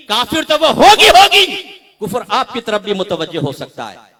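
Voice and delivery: high, loud, pounding list of reproaches; fiery preaching. Real, not acted. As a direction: hammer the husbands with their responsibility. Preaches at full force to husbands. (गरजती, जोशीली तक़रीर)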